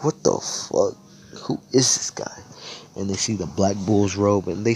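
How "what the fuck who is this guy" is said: whispered aside. Retold or imitated. Mages reacting to newcomer.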